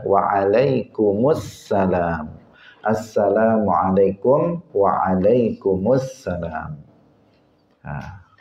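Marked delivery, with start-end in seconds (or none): 0.0-6.7 slow, careful "Assalamualaikum" and "Waalaikumsalam"; correct greeting. Shown as a demonstration. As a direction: say each word slowly and fully. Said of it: "Semestinya apa?".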